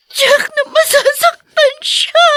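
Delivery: high, slow, crying voice; sobbing over his mother; breaking into sobs. Acted. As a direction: let the voice crack into crying. Son imagining mother's hurt.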